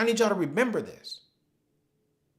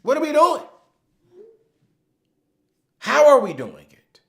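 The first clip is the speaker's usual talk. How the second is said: rallying shout; 12 semitones higher, 13 dB louder than usual. Real, not acted. Rallying webinar audience.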